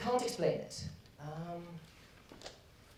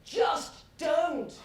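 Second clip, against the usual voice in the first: shouting; 8 semitones higher, 4 dB louder than usual. Acted. Dionysus snaps at servant.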